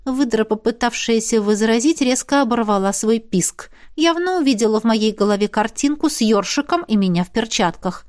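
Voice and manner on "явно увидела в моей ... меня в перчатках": usual speaking voice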